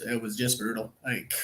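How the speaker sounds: choked up with emotion, trailing quiet. Real, not acted. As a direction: voice catching, holding back tears. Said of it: "I'm, I'm choked up".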